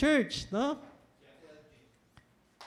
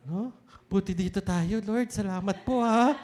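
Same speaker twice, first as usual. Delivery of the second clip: normal talking voice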